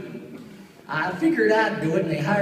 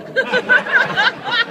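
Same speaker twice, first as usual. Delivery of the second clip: high wheezy cackle; 6 dB louder than usual. Retold or imitated. Buttram laugh.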